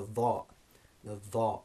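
"dha" as a heavy voiced TH; emphatic DH. Shown as a demonstration. Teacher introducing an Arabic letter, heavy emphatic "dha". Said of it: "the emphatic version of the dhal sound".

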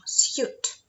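Suit whispered again; whispered word. Shown as a demonstration. Teacher whispering the word while matching. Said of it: "Відкритий склад бачимо".

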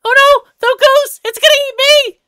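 high, loud, hammed-up doom; terrified squirrel. Squirrel about to be eaten by the goose, terrified. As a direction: wail in high-pitched panic.

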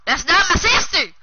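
loud, high-pitched protest; indignant yell. Real, not acted. Kid protesting teasing about his sister, yelling. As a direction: shout the denial.

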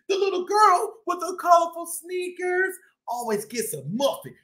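lively shopkeeper cat telling his story; boastful bodega-boss cat, upbeat; cat narrator Chip. Acted.

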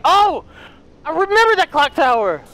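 delighted jolt of recognition; excited recognition. Real, not acted. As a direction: exclaim as it comes back to you. Suddenly recognising the clock tower.

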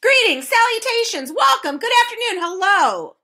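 high, ringing string of courtly greetings; ceremonious welcome, high-pitched; formal greeters. Acted. Courtiers take turns greeting Milo.

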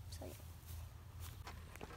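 whispered aside to viewers. Acted. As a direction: trail off into a whisper.

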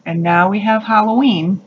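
usual speaking voice

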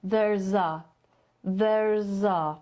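"There's a" blended into one chunk; linked there's a. Shown as a demonstration. Teacher showing the words melt into one chunk. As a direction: glue "There's a" together with no break. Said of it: "łączyć wymowa w jedno".

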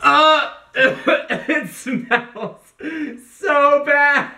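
high-pitched, laughing complaint; real laughter breaking into the words; laughing disgust. Real, not acted. Host reacts to the smell of the smoothie, laughing in disgust.

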